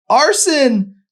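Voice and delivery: very loud, very high scream of his name. Acted. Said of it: "she screamed".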